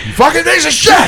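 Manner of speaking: furious customer screaming at tech support; enraged caller cursing out the support line; angry caller yell. Pretend. Playing angry caller at CenturyLink.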